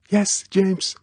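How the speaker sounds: put-on high girl's voice with relief; relieved. A narrator's reading. Girl nearby answering Bond's call, relieved. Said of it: "There was a relief in the nearby voice".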